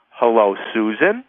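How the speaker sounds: "Susan" rising sharply into a question; questioning greeting. Shown as a demonstration. Cold caller to a stranger, questioning greeting. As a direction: say "Susan" as if asking whether it's her. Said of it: "I would say it as a question".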